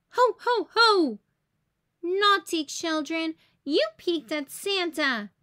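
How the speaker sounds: high, loud mock scolding; playful pretend telling-off; mock Santa scolding. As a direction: tell the naughty kids off as Santa. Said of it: "she scolds".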